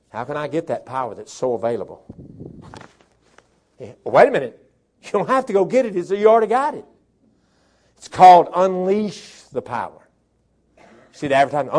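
usual speaking voice

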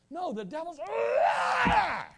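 very high, slow shout, stretching liar; climactic shout. Retold or imitated. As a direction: scream it at full force. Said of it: "I said".

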